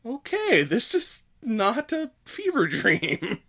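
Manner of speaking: high, drawn-out imitated disbelief; stretched-out pretend amazement breaking into laughter; mock dawning realisation.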